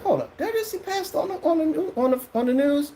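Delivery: high shocked hold up; seeing the pastor on the news, high disbelief. Retold or imitated. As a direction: jump up in pitch at the TV. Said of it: "I was like".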